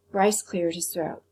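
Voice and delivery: usual speaking voice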